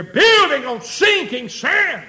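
high, loud, drawn-out warning; preaching shout. Real, not acted. Preacher driving the warning home, shouted. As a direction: cry it out slowly at full voice.

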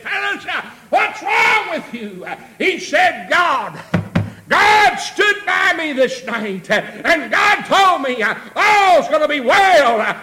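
loud, high-pitched sermon shout; full-force preaching. Real, not acted. Preacher rallying the church through the storm, full-force preaching. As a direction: drive it home to the whole congregation at full volume.

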